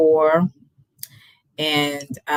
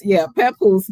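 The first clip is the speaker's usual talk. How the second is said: normal talking voice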